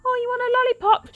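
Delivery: high, fast sing-song lure; hammed-up sweet lure of a stranger; creepy kidnapper. Imagined kidnapper luring a child, mock sweet and high.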